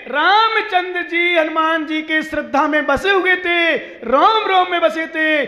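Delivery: loud, high, emphatic sermon delivery; full-force preaching. Real, not acted. Preacher insisting Ram lived in Hanuman's faith, full-force preaching. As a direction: drive each phrase home to the crowd.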